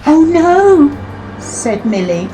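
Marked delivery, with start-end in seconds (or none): 0.1-0.9 sudden high alarmed cry. Acted. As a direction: squeal in alarm. Engine realises danger.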